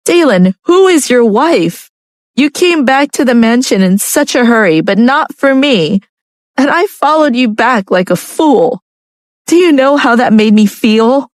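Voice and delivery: tearful wife. A narrator's reading. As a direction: reproach him through tears. Tears, narrator voicing Lucia.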